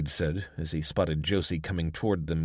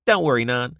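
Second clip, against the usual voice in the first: teasing barkeep; 6 semitones higher, 9 dB louder than usual. Acted. Josie reassures Wade.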